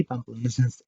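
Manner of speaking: ordinary delivery, nothing marked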